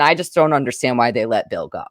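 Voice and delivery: ordinary delivery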